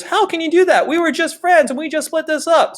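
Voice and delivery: high, indignant mock protest; mock Austria. Austria protesting to Prussia, high hurt mock whine. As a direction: play a betrayed friend whining at Prussia. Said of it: "Austria goes".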